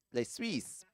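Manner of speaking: Les Suisses in a soft French accent; French words. Shown as a demonstration. Commentator jokingly naming the Swiss in French.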